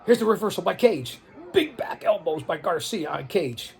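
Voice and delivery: excited ringside play-by-play; wrestling commentator. Acted. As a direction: call the match live.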